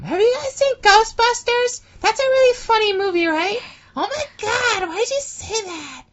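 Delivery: very high, loud witchy cackle-voice; Wicked Witch voice. Retold or imitated. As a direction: screech like the Wicked Witch of the West. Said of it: "I'm using that voice".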